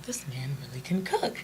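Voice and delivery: breathless hushed whisper of amazement; whispered in awe of the food; awed whisper. Retold or imitated. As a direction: breathe it out like a secret discovery. Recalling tasting husband's food.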